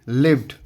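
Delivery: लिव्ड said as one syllable "livd", falling; model pronunciation. Shown as a demonstration. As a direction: say लिव्ड short, ending in a "d", falling tone. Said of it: "इसको पढ़ेंगे लिव्ड".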